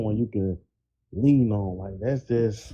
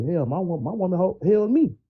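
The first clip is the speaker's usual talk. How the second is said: rising emphatic quote; 8 semitones higher than usual. Retold or imitated. Voicing real man's advice.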